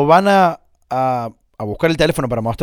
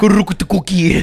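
mock gibberish; 2 semitones higher than usual. Gibberish duel.

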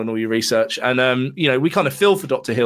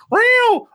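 very high, loud mock meow; falsetto meow; 5 dB louder than usual. Host mocks the film's off-screen cat, a falsetto meow. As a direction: squeak a fake cat meow.

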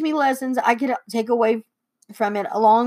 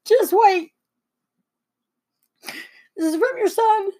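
high, choked-up announcement; trying not to cry; 9 semitones higher than usual. Real, not acted. Daughter introducing the son's letter to Mom, near tears. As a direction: squeeze it out through held-back tears. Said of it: "I'm trying not to cry".

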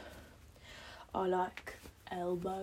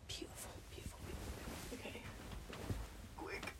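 whispering; 14 dB quieter than usual. Real, not acted. Whispered satisfaction.

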